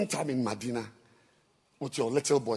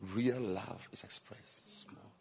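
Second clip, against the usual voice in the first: slow breathy whisper; 10 dB quieter, slower than usual. Shown as a demonstration. Preacher teaching the congregation true love, slow whisper. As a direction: whisper it slowly like a secret.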